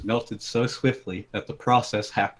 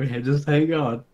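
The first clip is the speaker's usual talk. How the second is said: usual speaking voice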